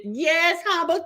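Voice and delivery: ordinary delivery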